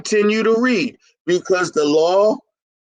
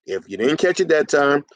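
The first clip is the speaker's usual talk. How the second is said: ordinary talk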